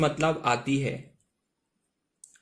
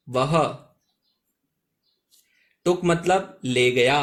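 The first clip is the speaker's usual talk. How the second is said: usual speaking voice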